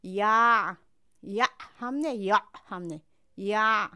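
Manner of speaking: یا hit loudly, then corrected; loud drill. Shown as a demonstration. Teacher contrasting wrong and right ع loudly. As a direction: repeat یا loudly from mid-throat. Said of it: "از نصف گلو تلفظ کنید".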